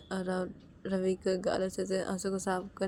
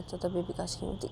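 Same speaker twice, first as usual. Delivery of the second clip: ordinary delivery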